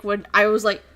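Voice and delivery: ordinary delivery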